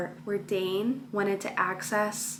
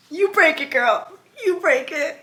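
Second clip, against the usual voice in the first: cheering her on; 5 semitones higher, 5 dB louder than usual. Real, not acted. Reader cheering the heroine to her viewers, loud and high.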